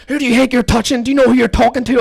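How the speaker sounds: high, loud mock-outraged screech; in-your-face challenge. Woman got up in teller's face, outraged mock screech. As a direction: imitate her squaring up, outraged. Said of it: "she got up in my face".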